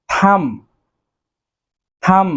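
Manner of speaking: থাম said slowly and clearly, with the final b silent; careful pronunciation. Shown as a demonstration. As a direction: model the word থাম for the class. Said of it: "Then রয়েছে".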